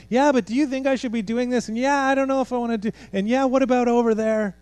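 high, fast, whiny mock questions; whiny doubter. Preacher mocking distracted believers with high whiny questions. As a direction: mimic a fidgety, doubting follower.

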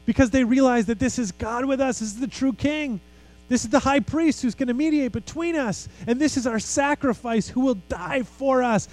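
high, joyful sermon delivery; excited preaching. Real, not acted. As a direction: proclaim the great news, pitch held high.

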